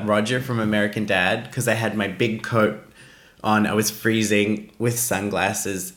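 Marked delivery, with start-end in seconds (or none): none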